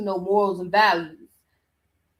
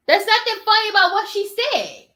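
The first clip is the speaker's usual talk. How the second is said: higher, louder indignant scolding; 9 semitones higher, 5 dB louder than usual. Real, not acted. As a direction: tell them off, nothing here is funny. Scolding the interviewer.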